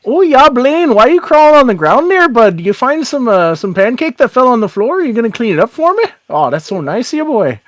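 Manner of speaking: swooping, singsong small-town lilt; folksy Irish-Canadian officer, teasing. Acted. Town officer to boy crawling on floor, folksy teasing. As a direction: chat down to a boy like a friendly local cop.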